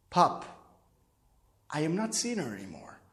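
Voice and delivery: low, very soft, careful admission; nervous. Retold or imitated. Son telling his father it is over, timid and hushed. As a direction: break it to him quietly, with hesitation. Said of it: "Then I start sound like we nervous".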